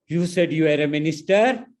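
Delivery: loud, high, scornful question; woman on the plane mocking the minister, loud and angry; angry mocking retort. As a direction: throw his title back at him in disbelief. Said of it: "laughing angrily".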